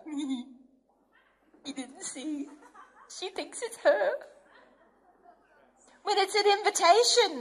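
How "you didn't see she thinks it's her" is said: mocking devil laughing; mock devil chuckling. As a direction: gloat through laughter like a sly devil. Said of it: "it's actually the devil going".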